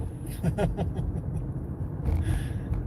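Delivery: long breathy mocking chuckle; a sneering laugh at the speaker; scornful laugh. Real, not acted. Mocking the speaker's claim, a scornful laugh.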